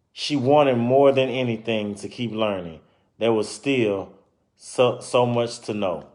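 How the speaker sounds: lively storybook narration; reading to children. Acted. Reading a children's book aloud to listeners, lively storytelling. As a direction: read it out like a picture book for kids.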